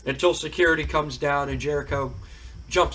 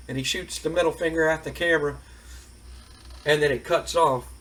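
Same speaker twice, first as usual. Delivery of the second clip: usual speaking voice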